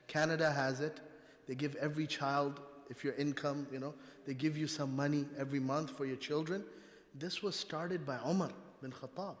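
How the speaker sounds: usual speaking voice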